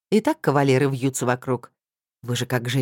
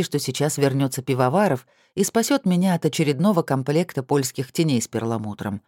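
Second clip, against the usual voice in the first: usual speaking voice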